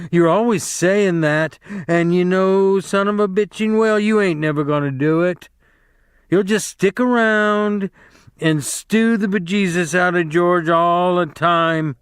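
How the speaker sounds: high, loud scolding tirade; sharp scolding aunt. A narrator's reading. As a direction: scold him without letting up. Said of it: "she said sharply".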